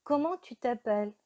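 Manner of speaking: ordinary talk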